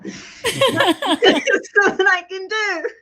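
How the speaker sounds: high, slow, crying through laughter; helpless tears and laughter; laughing cry. Real, not acted. Healer overcome by the message, laughing and crying. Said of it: "It's making me cry".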